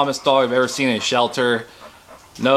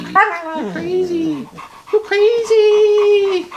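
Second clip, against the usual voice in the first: much higher, slow pet voice, louder; 4 dB louder, much slower than usual. Real, not acted. Owner teasing her crazy puppy in slow high baby talk. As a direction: coo it out long and high.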